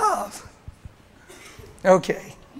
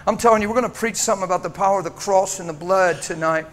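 ordinary delivery

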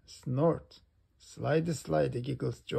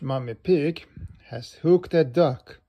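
plain reading voice

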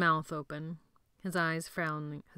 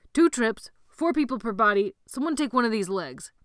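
loud, high commands; shouted orders; 4 semitones higher, 6 dB louder than usual. Acted. Sebastian directing boys hauling bodies, shouting. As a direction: call out orders over the noise.

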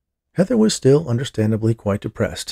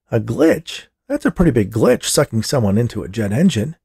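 higher, emphatic echo of disbelief; incredulous; 4 semitones higher, 4 dB louder than usual. Acted. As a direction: throw the word back in disbelief. Disbelieving reply to officer's explanation.

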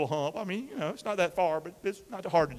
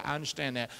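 ordinary talk, nothing marked

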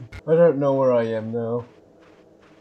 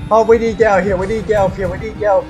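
high, panicked, repeated urging; frantic urging; 8 semitones higher, 5 dB louder, much faster than usual. Real, not acted. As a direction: urge everyone to escape at once. Urging escape from the monster.

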